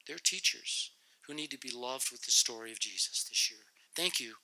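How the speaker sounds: soft earnest prayer voice. Acted. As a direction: pray humbly to close. Closing prayer.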